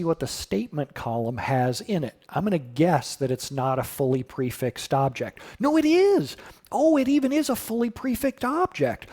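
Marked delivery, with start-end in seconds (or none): 5.6-9.1 surprised. Real, not acted. Guess proven wrong on screen.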